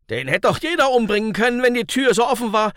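fast, high, loud and desperate; desperate objection. A narrator's reading. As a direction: burst out in desperate self-defence. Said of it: "wandte Michael verzweifelt ein".

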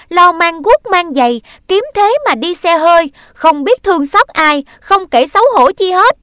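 loud, high, fast and rising; bitter tirade. Acted. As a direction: pour out the grievance in a shout. Brother's long angry rebuke.

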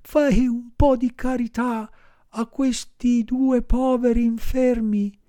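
high, slow, pitiful whine; whining voice. Acted. Fox begging Pinocchio for charity, high whining. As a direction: wheedle like a beggar fox. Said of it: "con voce di piagnisteo".

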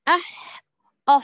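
أح said twice, first thin then full; two takes of أح; student's ha drill. Shown as a demonstration. Student drilling أح, thin then full, for the class. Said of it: "فتقوم الأخت وتقول".

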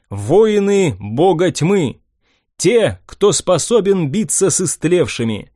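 rally the troops with conviction; rousing speech. Acted. Hero addresses the crowd.

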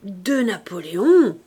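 put-on loud, slow old toothless woman; vieille édentée qui grommelle. A narrator's reading. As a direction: grumble it out loudly, drawn out, as an old crone. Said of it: "grommela une vieille édentée". (vieille femme édentée, grommelant fort et lentement)

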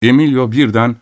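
ordinary delivery

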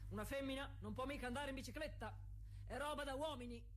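imitating the critics who say a woman can't ride; mocking the men. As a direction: parrot their sneer back at them. Mocking what men say about her.

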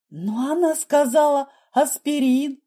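high, drawn-out plea; panicked protest. A narrator's reading. Nurse defends herself about the allergy, pleading in panic.

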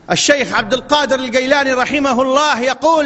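normal talking voice

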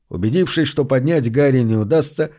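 plain reading voice

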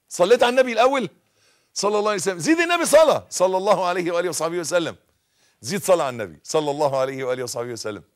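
loud, high, fast call to the crowd; rousing crowd call. Acted. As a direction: whip the crowd into chanting. Leading crowd in blessings. (حث الجمهور على الصلاة على النبي بصوت عال)

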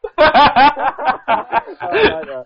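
long, high-pitched cackling laugh; helpless high laugh; high laughter. Real, not acted. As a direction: burst out laughing after the prank. Laughing after the prank call.

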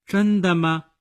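cocky and disbelieving, voice lifted; very arrogant. A narrator's reading. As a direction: throw the arrogance right back. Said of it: "就也非常傲慢地说".

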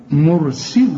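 مرسل with a thick r, then a thinner s; heavy ra. Shown as a demonstration. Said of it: "هنا الراء مفخمة".

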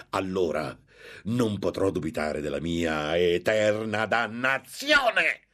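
grida alte e lente; parole vomitate. A narrator's reading. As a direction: maledice sé stesso davanti alla vittima. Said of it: "Vomitò queste parole con una voce di". (sfogo rabbioso e disperato del monaco)

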